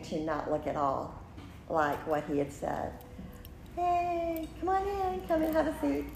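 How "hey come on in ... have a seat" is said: bright, high, friendly greeting in someone else's voice; high welcoming voice. Retold or imitated. Acting out a friendly host inviting her to sit, high and welcoming. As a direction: lightly act out a cheerful host waving her in.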